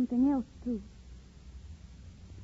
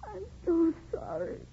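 crying apology; 5 semitones higher, 3 dB quieter than usual. Acted. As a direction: apologise through the tears. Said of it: "girl, stop crying".

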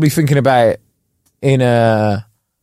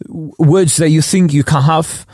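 higher, hammed-up French accent in English; French expert's accent; 3 semitones higher than usual. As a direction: carry on as the French pundit. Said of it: "the French expert that comes on".

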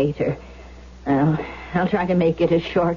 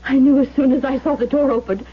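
frightened; 6 semitones higher than usual. Acted. Maid panics about the ghost.

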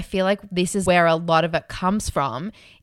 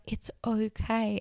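soft, slow, much softer "it's okay"; calm reassurance; 2 semitones higher, 10 dB quieter, slower than usual. Retold or imitated. Friend calming her on the plane, soft reassurance. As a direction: soothe a panicked friend gently. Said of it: "you were like".

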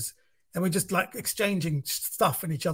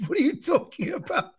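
much higher, laughing in disbelief; 11 semitones higher than usual. Retold or imitated. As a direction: act baffled through a laugh. Incredulous reply to wife's joke.

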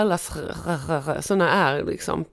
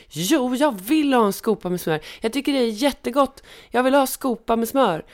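imitating insistent child; 6 semitones higher than usual. Barnet insisterar igen.

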